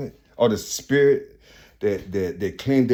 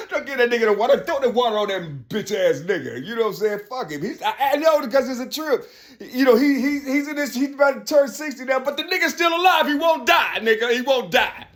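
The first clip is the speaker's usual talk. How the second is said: mock scornful onlooker; 13 semitones higher than usual. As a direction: shriek it in disgust. Mocking onlookers continue.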